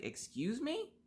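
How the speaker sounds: sharply rising, incredulous and breathy-soft; offended high rise on me; indignant excuse me. Retold or imitated. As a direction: throw it back at her in disbelief. Mom accuses her over the dog.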